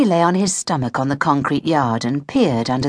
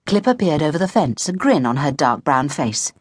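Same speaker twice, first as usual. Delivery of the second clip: plain reading voice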